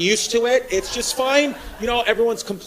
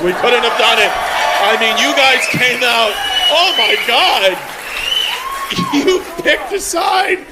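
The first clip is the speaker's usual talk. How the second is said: loud, drawn-out mock elation; mock gushing; 3 semitones higher, 5 dB louder than usual. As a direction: shout slow, overdone praise at the room.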